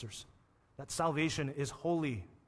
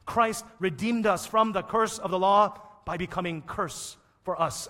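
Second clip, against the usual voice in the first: ordinary delivery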